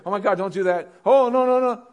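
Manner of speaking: high, loud mock fretting; mock panic. Preacher mocks an anxious believer, hammed-up high loud panic. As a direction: imitate a panicking worrier, hammed-up.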